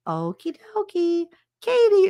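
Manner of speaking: Nurse Helen's voice. Retold or imitated. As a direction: greet sweetly like a calm school nurse.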